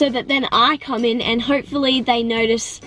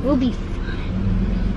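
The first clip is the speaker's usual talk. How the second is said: usual speaking voice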